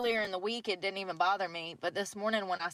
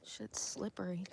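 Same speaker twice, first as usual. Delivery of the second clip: whispering; 2 semitones lower, 9 dB quieter than usual. Real, not acted. Whispering on icy ground.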